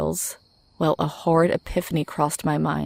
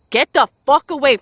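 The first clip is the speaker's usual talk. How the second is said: loud, higher-pitched desperate yell; 9 semitones higher, 8 dB louder than usual. A narrator's reading. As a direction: yell to drive off a threat. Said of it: "I yelled as loudly as I could".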